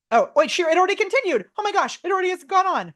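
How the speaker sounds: high-pitched, delighted astonishment; real surprise. Real, not acted. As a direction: caught off guard and amazed. Caught off guard by fast output.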